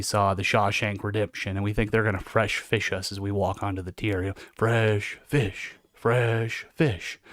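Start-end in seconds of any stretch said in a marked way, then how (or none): 4.5-7.2 repeated, drawn-out heckling chant; mock inmate taunt. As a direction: imitate convicts harassing a newcomer. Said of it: "Harassing the new guy".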